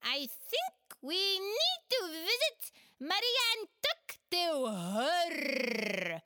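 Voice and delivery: an eager little creature with a plan; Chulur's voice. Acted. Chulur proposes visiting Maria, in a high, loud voice.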